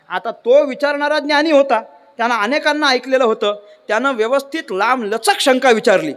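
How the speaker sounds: usual speaking voice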